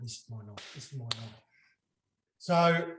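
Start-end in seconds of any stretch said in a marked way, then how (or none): 0.0-1.3 low, soft, self-satisfied; low smug murmur. Retold or imitated. Telling himself quietly at the back, smug. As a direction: murmur it low like a secret win. Retold inner voice, pleased.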